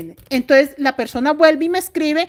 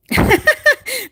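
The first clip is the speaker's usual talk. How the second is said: a burst of high-pitched laughter; bright giggle at the viewer's message; 12 semitones higher, 3 dB louder than usual. Real, not acted. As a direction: laugh out, amused by the comment. Reading a funny comment aloud.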